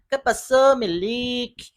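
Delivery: high, loud Mexican street slang voice; Chimoltrúfia impression. Fan imitating Chimoltrúfia's street accent, high and loud. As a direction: pitch up and belt it like Chimoltrúfia. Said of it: "eu aqui tentando imitar a Chimoltrufia".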